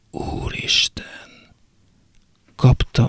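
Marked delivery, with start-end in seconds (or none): 0.1-1.1 breathless whispered gasp; half-voiced horrified exclamation; shocked whisper.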